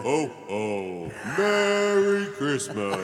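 slow drawn-out Santa with reverb; jolly Santa in an echo chamber. Pretend. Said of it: "Try it with some echo here".

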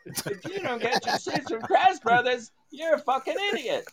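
very high squeaky pitchman voice; mock ad pitch. Friend parodying the store commercial, squeaky high pitchman. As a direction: parody the cheesy ad. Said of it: "Crash Brothers had the best commercials".

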